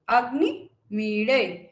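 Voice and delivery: drawn out अग्नि मीड़े with मी kept level; level svarita on मी. Shown as a demonstration. Teacher demonstrating svarita on मी to students. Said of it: "मी को जो है हम ना ही निम्न".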